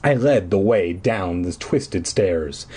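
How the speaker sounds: usual speaking voice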